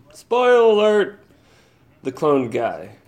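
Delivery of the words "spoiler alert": high loud "spoiler alert" call; mock announcer. Podcaster warning listeners of a spoiler, mock announcer call.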